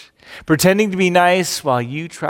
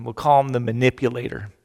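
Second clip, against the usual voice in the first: normal talking voice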